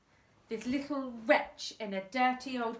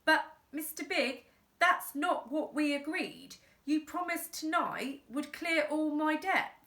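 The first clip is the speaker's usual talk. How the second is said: pleading dad; 6 semitones higher than usual. Acted.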